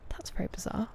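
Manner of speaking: whispered aside. Real, not acted. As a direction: say it under your breath in a whisper. Aside about chat message.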